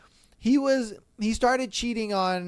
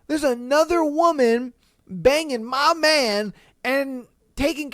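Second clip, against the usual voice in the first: ordinary delivery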